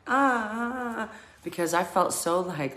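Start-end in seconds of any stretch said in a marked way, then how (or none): 0.1-1.1 high imitated scream cry; mock scream. Retelling joining in, a high mock scream. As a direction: squeal it like forced screaming. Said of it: "And I'm, like, going".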